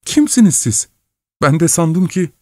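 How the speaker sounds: high-pitched woman's voice; startled wife. A narrator's reading. Wife to the young man from under the bed, startled. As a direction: ask in high-pitched surprise.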